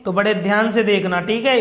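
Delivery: ordinary delivery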